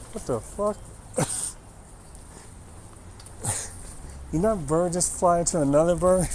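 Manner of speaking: sudden high startled cry, half laughing; jolted mid-sentence by a bird hitting the house; startled outburst. Real, not acted. Interrupting own story, startled by a bird crashing.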